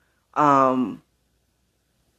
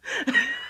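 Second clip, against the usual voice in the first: high squeaky giggle; 6 dB quieter than usual. Real, not acted. Laughs at own joke.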